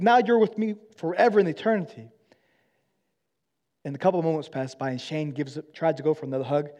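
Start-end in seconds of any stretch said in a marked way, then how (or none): 0.0-2.1 joyful celebration. Retold or imitated. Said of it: "He's like".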